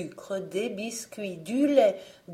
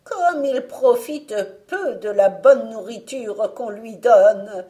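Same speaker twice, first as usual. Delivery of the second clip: grumbling witch; 3 semitones higher, 9 dB louder than usual. Acted. Witch complaining Jean stays thin, grumbling witch voice. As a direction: grumble like a cheated hag.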